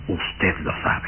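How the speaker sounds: susurrado despacio, sin voz; susurro lento. Acted. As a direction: susurrarle al oído con intención. El conde seduce a Ruth. (el conde le susurra a Ruth, lento)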